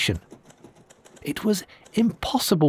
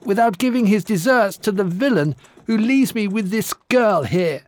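angry giantess; 7 semitones higher than usual. Acted. Giantess denounces the groom.

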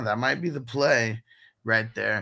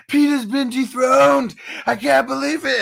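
imitating an elated outburst; high, loud, drawn-out mock celebration; happy, excited shout; 12 semitones higher, 8 dB louder than usual. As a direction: crow it like a sitcom victor. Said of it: "he's so happy".

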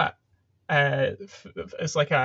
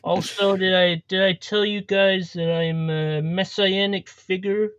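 rambling mock politician, halting and self-satisfied; bad Trump impression. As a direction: boast like a badly imitated American president. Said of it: "do a really bad impression".

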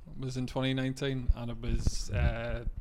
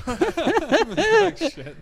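laughing, much higher and louder; 12 dB louder than usual. Real, not acted. Comedian laughing at his own awkward gig, loud high laugh. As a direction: laugh out the disbelief. Laughing at the police joke outcome.

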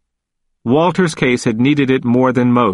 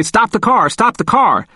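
imitating an excited cousin, higher; 9 semitones higher than usual. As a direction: call out to stop the car, eager. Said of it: "and he'd say".

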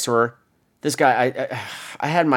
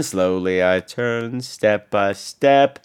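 low and drawn out, word by word; slow, ominous recitation; 5 semitones lower, much slower than usual. Acted. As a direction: stretch each word like a slow turn. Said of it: "the slow head turn".

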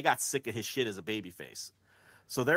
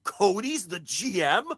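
mock awestruck fan; 9 semitones higher, 6 dB louder than usual. Fans amazed Cody is GM, hammed-up high awe. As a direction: gasp it out like a dazzled fan. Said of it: "people who are like".

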